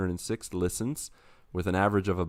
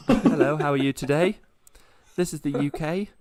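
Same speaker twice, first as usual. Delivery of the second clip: higher, louder put-on British greeting; British accent attempt; 7 semitones higher, 3 dB louder than usual. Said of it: "no, that, I was doing my Australian accent".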